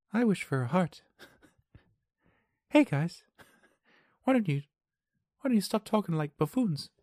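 high, light put-on voice; Tin Man impression. Addressing the others, high mock Tin Man voice. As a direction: pitch it high, a mock storybook figure. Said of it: "the Tin Man was just like".

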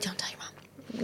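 hushed secret whisper from auntie. Retold or imitated. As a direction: whisper it like a conspiring aunt. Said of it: "And she'd be like".

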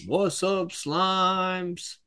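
high, hyped slang shout-out; casual street slang. Retold or imitated. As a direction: greet the crowd like a rapper. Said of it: "he goes up to the podium and he's like".

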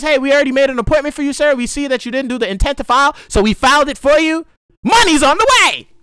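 high, loud, fast sing-song clerk voice; mock cheery VA clerk. Ranting vet mimics a dream VA clerk, high and fast. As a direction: rattle it off like an overeager clerk. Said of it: "Nobody says".

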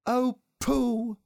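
high chorus-like gush; admiring exclamation. A narrator's reading. Everybody admiring Pooh's present, high exclamation.

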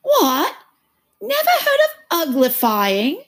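high, loud exclamation; slow, incredulous creature squawk; astonished Gryphon. A narrator's reading. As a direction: exclaim in disbelief. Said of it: "lifted up both paws in surprise".

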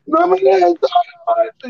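high, stretched-out pleading cry; mock yell. Caught friend begging not to be left, mock yell. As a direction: wail it slowly, high and begging.

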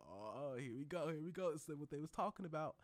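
gleeful, like a spoiled moment finally arriving; excited anticipation. Retold or imitated. Moviegoer spotting the spoiled scene coming, excited. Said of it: "I'm gonna be like".

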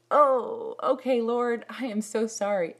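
high, loud sudden realization; humbled surprise. Retold or imitated. Humbled worshipper, realizing her mistake, says sorry to God. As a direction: catch yourself, then apologize. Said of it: "I was just like".

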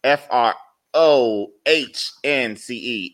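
slow letter-by-letter spelling with pauses; spelled out slowly. Shown as a demonstration. Explaining his name to viewers, spelled out slowly. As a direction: spell it out, one letter at a time. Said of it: "it's pronounced".

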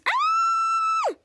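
very high, sustained squeal; acted scream. Girl splashed with water, imitated high scream. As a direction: scream thinly without full volume. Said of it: "And she screams".

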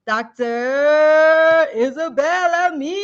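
slow, loud, stretched-out announcer call; grand announcer intro. Acted. Host announcing the guest to the audience, grand and slow. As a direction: bring her on like a show host, drawing out every syllable. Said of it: "what an entrance".

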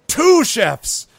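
loud high triumphant call; triumphant demand. Acted. Goblin chief naming his price, loud and proud.

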